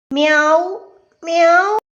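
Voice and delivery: imitated kitten's cry, rising at the end; voiced little cat mew; kitten meowing. A narrator's reading. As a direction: meow like a crying kitten. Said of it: "കുഞ്ഞിയുടെ കരച്ചിൽ".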